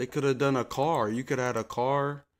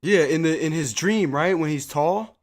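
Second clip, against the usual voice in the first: louder, higher, eager retelling; excited recall; 5 semitones higher, 6 dB louder than usual. Real, not acted. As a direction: gush about the episode.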